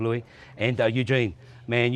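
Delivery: ordinary talk, nothing marked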